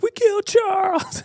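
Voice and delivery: put-on very high, wailing little boy; imitating crying son. Dad voicing his son upset over the squirrel, high wail. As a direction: whimper it like a heartbroken child.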